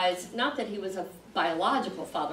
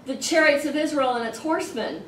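high, raised voice of a quoted outcry; crying out; 7 semitones higher, 5 dB louder than usual. Retold or imitated. Elisha watches his master taken up, crying out. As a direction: call it out loud, reading the prophet's cry. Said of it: "And then he cries out".

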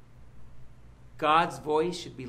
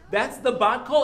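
higher and louder, laughing with delight; excited with a laugh; 7 semitones higher, 9 dB louder than usual. Real, not acted. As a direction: burst out joyfully with a laugh.

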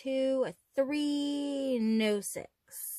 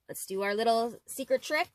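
usual speaking voice

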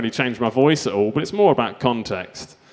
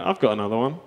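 ordinary talk

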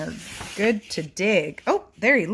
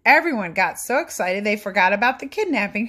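put-on lively read-aloud for children; animated picture book narration; storybook reading; 3 semitones higher, 5 dB louder than usual. A narrator's reading. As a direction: read it like bedtime story time.

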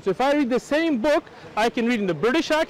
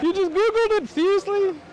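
pitched way up in disbelief; incredulous; 8 semitones higher than usual. Real, not acted. Debater mocking an opponent who Googled his source, incredulous. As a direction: scoff at him for just Googling it.

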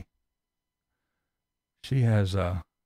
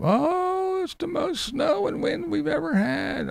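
very high mock whine; mocking whiny quote; slower than usual. Vlogger quoting another YouTuber's snow complaint, very high and whiny. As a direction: squeak it out like a whiner.

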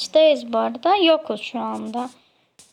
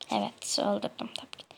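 breathless whisper, much softer; whispered gloat; 3 semitones lower, 9 dB quieter than usual. Real, not acted. Gamer privately gloats about her kill, whispering. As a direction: hush it like a sly secret.

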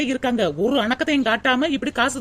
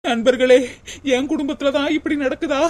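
ordinary delivery

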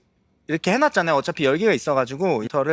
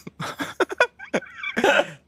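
very high, squeaky laugh; long, shrieking giggle; helpless laughter. Real, not acted. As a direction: keep giggling at the pun. Laughs at the pun.

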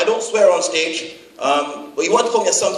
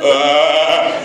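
put-on French accent; 4 semitones lower than usual.

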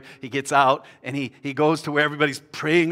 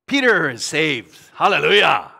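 jubilant proclamation; 8 dB louder than usual. Real, not acted. Preacher celebrating Peter's rescue, jubilant proclamation. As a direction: proclaim it to the whole church.

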